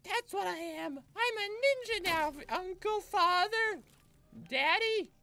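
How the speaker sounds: put-on high, slow whiny child bragging; bratty kid voice. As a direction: squeal it like a proud little kid.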